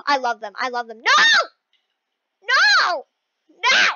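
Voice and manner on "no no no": three loud, high cries of no; dismayed shouting. Real, not acted. Player falling near the win, shouting in dismay. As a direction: yell each no in disbelief. Falls just short of winning.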